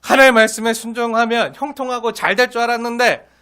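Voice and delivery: usual speaking voice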